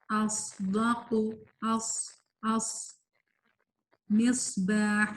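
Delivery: slow, thick ص with a whistling hiss; heavy articulation. Shown as a demonstration. Teacher reading examples to students, slow heavy ص. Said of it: "یہ موٹا حرف ہے".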